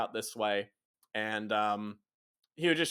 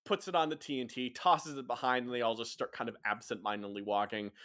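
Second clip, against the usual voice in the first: normal talking voice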